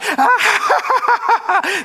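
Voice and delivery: high maniacal Joker cackle; evil menacing laugh. Retold or imitated. Preacher imitates the Joker's evil cackle for the church. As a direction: cackle like a grinning villain. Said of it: "this evil, menacing laugh".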